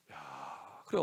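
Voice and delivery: normal talking voice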